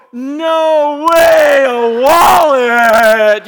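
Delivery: overjoyed at the gift, stretch wallet out; delighted shout. Retold or imitated. Father thrilled by daughter's wallet, joyful shout. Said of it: "I go".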